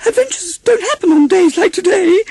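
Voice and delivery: very high, stammering and anxious; backing away while making excuses; nervous Piglet. Acted. Piglet dodging the adventure, high stammering fret. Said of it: "trying to sound knowledgeable".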